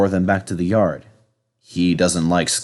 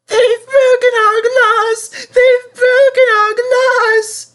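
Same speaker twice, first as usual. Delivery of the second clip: loud, very high, slow wail; sobbing; 7 dB louder, slower than usual. Acted. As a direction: sob it out in sing-song grief. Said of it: "sobbed the coaches".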